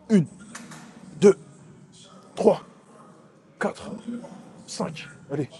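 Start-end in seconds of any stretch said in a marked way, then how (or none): none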